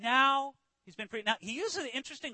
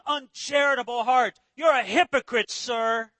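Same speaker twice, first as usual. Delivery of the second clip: forceful, raised-voice accusation; indignant rebuke; 2 semitones higher, 4 dB louder than usual. Acted. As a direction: confront a hypocrite square in the eye. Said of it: "a little bit indignant right back".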